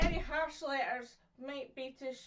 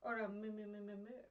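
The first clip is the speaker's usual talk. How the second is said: softer, lower "le, le" and "moo"; soft, soothing sounds; 4 semitones lower, 7 dB quieter than usual. Shown as a demonstration. Teacher showing soft letters, calm and soft. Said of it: "make a calm, soothing atmosphere".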